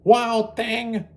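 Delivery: high, loud scolding call; mother shouting at her wild son. Acted. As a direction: call him out like an exasperated mother. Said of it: "his mother called him".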